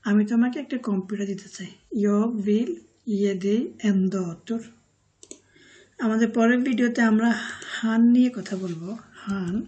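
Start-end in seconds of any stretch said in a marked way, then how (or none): 2.0-4.6 ge dig en dator said slowly and clearly; slow final read for learners to copy; slow model sentence. Shown as a demonstration. Teacher reads the full Swedish sentence slowly for viewers. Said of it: "teacher models Swedish sentence".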